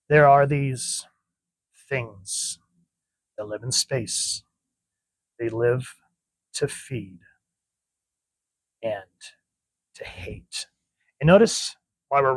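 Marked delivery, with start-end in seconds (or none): 0.1-10.7 slow, hushed, ominous storytelling; eerie comic narration. A narrator's reading. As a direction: draw them in with dread, dropping to a breath. Creepy narration of comic alien.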